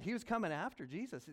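usual speaking voice

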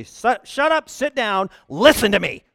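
loud, high sharp command; mock shout at a complainer; mock curt shout. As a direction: bark it like a fed-up pastor. Pastor voicing his curt reply.

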